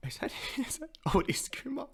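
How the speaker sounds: high, breathless whispered realization; excited whisper. Real, not acted. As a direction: whisper it like you can't believe it. Spotting Kuma, hushed excitement.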